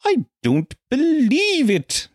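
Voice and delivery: high, slow, strained outraged whine; Victor Meldrew impression. Comedian imitates the sitcom grump's catchphrase, high and slow. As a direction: squeal it out in disbelief. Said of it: "your man ... Victor Meldrew?".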